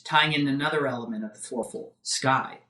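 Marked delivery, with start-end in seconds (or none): none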